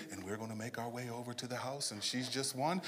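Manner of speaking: hushed announcer. Retold or imitated. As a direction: imitate a reporter sneaking up quietly. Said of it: "the guy holding the mic is very quiet".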